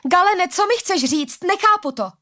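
high, pleading exclamation; desperate cry. A narrator's reading. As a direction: call out to Galen, baffled. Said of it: "vykřikla Arila".

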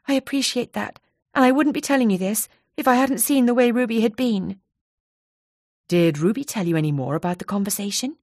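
lightly voiced anxious friend. Donna remembering Ruby fondly.

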